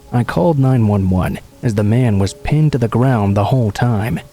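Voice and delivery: usual speaking voice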